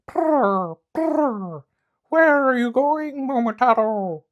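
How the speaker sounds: high, loud bird voice, drawn out slowly; trilling pheasant. Acted. Pheasant lands and asks Momotaro, slow high trill.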